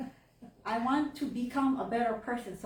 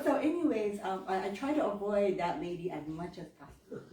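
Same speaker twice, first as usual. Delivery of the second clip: normal talking voice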